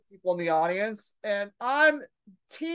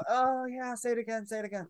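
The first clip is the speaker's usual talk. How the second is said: hushed, fading mock seduction; mock pillow talk; 2 semitones higher, 6 dB quieter than usual. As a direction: murmur it softer and softer, feigning arousal. Mocking drunk comics' pillow talk.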